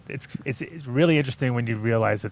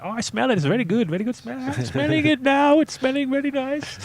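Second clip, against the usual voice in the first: very high-pitched put-on Indian accent; hammed-up Indian accent, climbing higher as it goes; mock Indian accent. Pretending to be technicians faking smell-o-vision, mock Indian accent. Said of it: "just a bunch of Indians".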